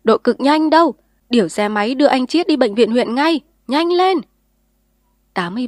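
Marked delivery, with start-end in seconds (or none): 0.0-4.2 loud, higher bellowed orders; old man yelling for help; roaring shout. A narrator's reading. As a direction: roar the orders. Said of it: "gào to".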